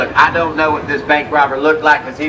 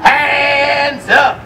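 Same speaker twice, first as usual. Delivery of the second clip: higher, hammed-up outlaw bark; loud mean voice; 8 semitones higher than usual. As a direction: play a mean robber demanding hands up. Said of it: "in a loud, mean voice".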